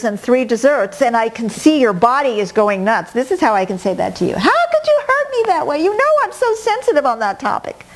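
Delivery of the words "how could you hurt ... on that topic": much higher, wounded whining voice; whiny hurt reply. Retold or imitated. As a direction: whine like someone deeply offended. Voicing offended loved one.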